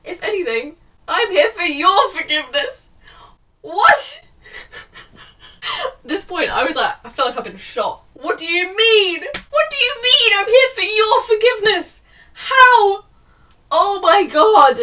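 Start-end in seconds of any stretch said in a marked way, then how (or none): 1.1-4.2 higher and louder, half crying with laughter; shrill disbelief. Real, not acted. Mock-reading then disbelief. 4.4-5.8 airy squeal of laughter; high breathy disbelieving laugh. Real, not acted. Reacting to the plot. 8.1-14.8 much higher, louder, wailing with laughter; crying-laughing disbelief. Real, not acted. Outrage at the plot twist.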